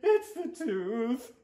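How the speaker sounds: put-on high, slow kid voice; whiny little boy. Stuart voice.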